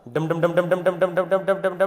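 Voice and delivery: put-on loud drumbeat syllables for children; damru drum imitation. Shown as a demonstration. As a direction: beat it out like a small drum. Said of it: "तो कैसे आती है आवाज़".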